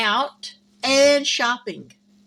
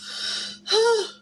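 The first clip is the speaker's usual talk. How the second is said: yawn; 12 semitones higher than usual. Real, not acted. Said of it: "I keep yawning".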